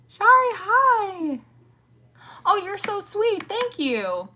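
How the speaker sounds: high, loud, stretched-out hello; light up and draw the hello out slowly; excited greeting. Real, not acted. Greeting a favourite viewer who just joined, high and delighted.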